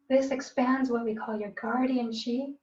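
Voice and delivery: ordinary talk, nothing marked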